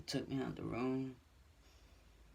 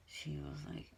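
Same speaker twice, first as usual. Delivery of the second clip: ordinary delivery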